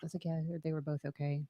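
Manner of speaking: barely audible, hushed; speaking way too softly; whispering on the phone. Real, not acted. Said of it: "Why, why are you whispering?".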